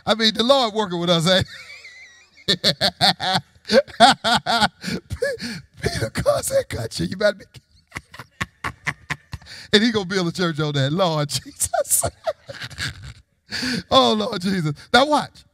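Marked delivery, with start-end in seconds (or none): 2.5-3.4 real laugh. Real, not acted. Amused by Peter's cussing. 7.9-9.7 soft, low, helpless laughter. Real, not acted. Amused by Peter's cussing. 12.1-13.1 soft, high wheezing laughter; helpless laugh. Real, not acted. Amused by church built on Peter.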